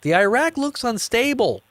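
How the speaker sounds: high doubtful reporter voice; mock reporter. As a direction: voice a skeptical journalist. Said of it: "press corps was like".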